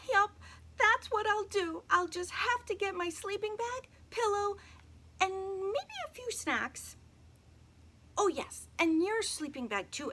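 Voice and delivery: put-on high, loud little girl planning; a little girl, determined and high; girl's voice. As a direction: chatter high to her doll. Emily talks to her doll.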